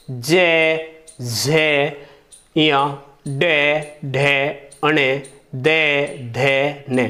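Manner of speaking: जय, झे, दे, धे slow and drawn out; voiced consonants demo. Shown as a demonstration. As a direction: stretch each voiced sound. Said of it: "आप बोल के देखेंगे". (सघोष व्यंजनों का धीमा, खिंचा उच्चारण)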